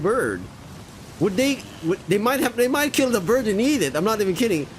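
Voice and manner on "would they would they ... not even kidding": higher, urgent warning; alarmed crewman. Acted. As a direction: blurt the worry out fast. Worried crewman about the bird.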